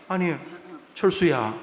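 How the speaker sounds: ordinary delivery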